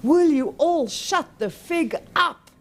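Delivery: loud, high, slow bellow over a crowd. Acted. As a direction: roar it to silence a rowdy audience. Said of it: "bellowed over the maddening crowd".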